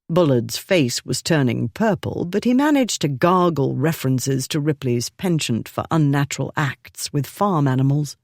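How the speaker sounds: ordinary delivery, nothing marked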